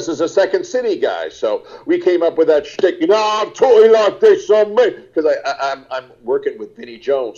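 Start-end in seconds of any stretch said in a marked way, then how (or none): 3.2-4.9 higher, put-on Cockney geezer voice; hamming up a Cockney lad like his co-star; Cockney accent. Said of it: "since he talks like this".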